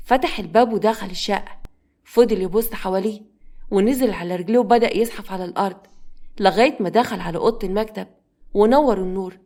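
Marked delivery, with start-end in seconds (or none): none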